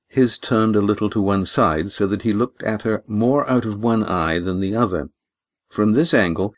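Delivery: usual speaking voice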